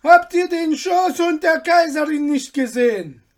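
high, loud slaves' cry; shouted from afar. A narrator's reading. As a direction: call out across a distance. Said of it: "sie schrien schon von weitem".

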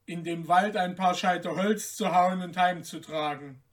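ordinary delivery

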